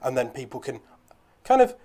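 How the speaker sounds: ordinary talk, nothing marked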